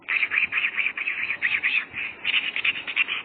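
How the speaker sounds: secret whisper, no voice at all; whisper in the ear. Acted. Nephew tells uncle his revenge plan, whispering. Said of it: "ওর কানে কানে বলছি".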